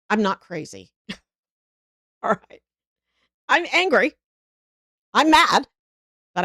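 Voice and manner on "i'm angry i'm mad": higher and louder, openly mad; angry. Real, not acted. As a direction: let the anger burst out.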